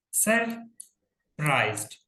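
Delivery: सरप्राइज्ड slowly, pausing after सर; teacher spacing out both halves of सरप्राइज्ड; two separate syllables. Shown as a demonstration. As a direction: split सरप्राइज्ड into सर and प्राइज्ड. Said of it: "जिसमें दो syllable हो".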